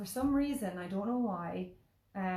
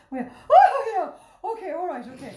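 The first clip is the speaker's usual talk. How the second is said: high, loud, hammed-up panic; panicked agreeing; 10 semitones higher, 14 dB louder than usual. Mother feigning interest in kids' phone, panicking. Feigning calm with kids during turbulence.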